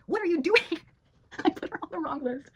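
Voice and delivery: ordinary talk, nothing marked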